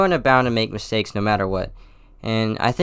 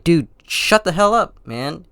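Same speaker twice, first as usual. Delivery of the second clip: retold snap back; 8 semitones higher, 5 dB louder than usual. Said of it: "I'm like".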